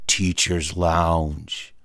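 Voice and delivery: very slow, low and grave; low, drawn-out mock dread; ominous sign reading. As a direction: draw out each word of the forbidden sign. Reads forbidden door sign.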